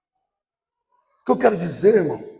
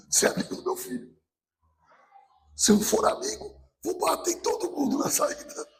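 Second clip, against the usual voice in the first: high, light voice of an overprotective parent; picture the dad who wants to fight the kids; playful parent; 10 semitones higher, 5 dB quieter than usual. Pretend. A parent voicing what he would do to protect his child.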